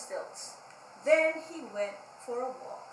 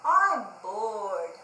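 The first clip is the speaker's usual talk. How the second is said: louder bored moan; 4 semitones lower, 7 dB louder than usual. Acted. Pig moaning to himself. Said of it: "he moaned".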